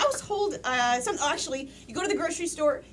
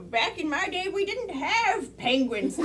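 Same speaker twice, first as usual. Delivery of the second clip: put-on slow, drawn-out old geezer; old-timer voice; 2 semitones higher, slower than usual. Shown as a demonstration. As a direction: grumble it like an old grandpa. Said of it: "they might say".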